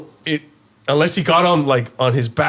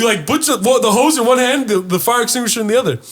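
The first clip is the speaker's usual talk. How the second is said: exasperated yell; 9 semitones higher than usual. Retold or imitated. Telling the neighbor to grab the extinguisher, yelling. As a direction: yell at him in disbelief, half laughing.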